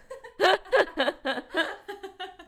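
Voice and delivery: short amused laugh; light laugh. Real, not acted. Laughing at own joke.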